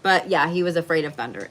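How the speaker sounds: usual speaking voice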